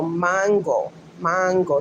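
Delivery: mango in a Spanish accent, MAHN-go; Spanish pronunciation. Shown as a demonstration. Teaching Luann the word, Spanish accent. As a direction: repeat Spanish mango with an open AH. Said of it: "That's how you pronounce it in Spanish".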